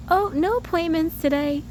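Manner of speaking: high, breezy singsong report; carefree. Retold or imitated. Imitating the lady who said it, carefree. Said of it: "Just, like, carefree".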